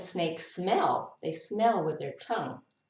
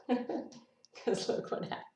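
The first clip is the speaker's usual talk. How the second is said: usual speaking voice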